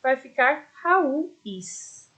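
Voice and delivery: Raúis drawn out slowly with the stress on ú; slow model plural. Shown as a demonstration. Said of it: "que é da vogal U".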